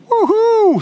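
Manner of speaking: high-pitched mock hoot; a long, swooping high "uhu"; playful woo-hoo. Preacher playing at the demonstration, playful high hoot.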